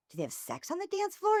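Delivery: high, feigned innocent question; mock shocked curiosity. Hostess feigning ignorance to disgusted girl, mock shocked curiosity. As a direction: pitch it up as if scandalized, playing dumb.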